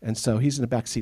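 ordinary delivery

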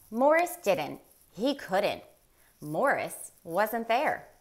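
usual speaking voice

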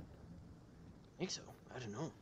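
soft hushed whisper. Real, not acted. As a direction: whisper it close. Talking softly, unvoiced.

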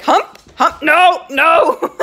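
high, silly voice for the dog; voicing the dog. Voicing Tucker the dog while he humps, high and silly. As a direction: put words in Tucker's mouth playfully. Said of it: "Tucker's like".

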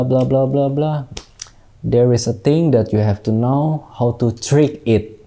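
normal talking voice

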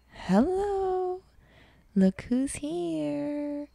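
high, slow sweet pet voice; cooing to a cat. Real, not acted. Greeting the cat arriving.

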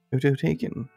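ordinary talk, nothing marked

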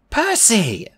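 urgent call. A narrator's reading. Invisible girl startles the boy.

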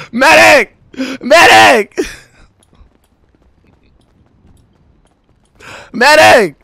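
high loud yell for a medic; man down shout. Real, not acted. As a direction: shout for help across the room. Friend passed out, calling help.

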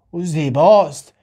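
ordinary talk

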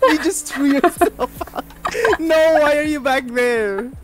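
high-pitched, helpless laughing voice; cracking up. Real, not acted. Teasing friend who threw herself in the river, cracking up. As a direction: squeal it out through laughter.

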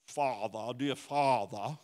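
slow, distant, pious 'dear Father'; mock old-fashioned devotion; phony reverent. Shown as a demonstration. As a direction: say it like a stiff formal prayer. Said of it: "it's not the Father like".

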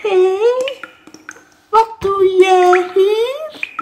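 high, bright little raindrop voice calling out; a cheerful raindrop calls to the boy, high and lively. Acted. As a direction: sing out like a tiny drop of water. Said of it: "riep ineens zo'n glanzend waterdruppeltje".

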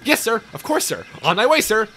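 high, eager yes-sir; James, hurried. A narrator's reading. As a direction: interrupt keenly, itching to leave. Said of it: "steamed James".

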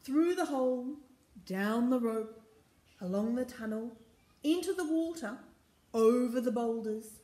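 rhythmic refrain, building suspense; story narration. A narrator's reading. Reader follows the creeping crew to a blockage, story narration. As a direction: read it phrase by phrase with emphasis.

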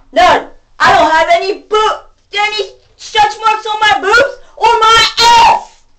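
crying out high and loud; shrill defiant shout; high angry shout. Real, not acted. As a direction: yell it, near tears. Heated rant, cries out.

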